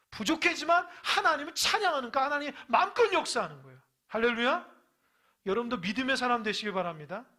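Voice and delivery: high, loud shouted sermon; fiery preaching. Acted. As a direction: preach it at full force. Preaching at full force.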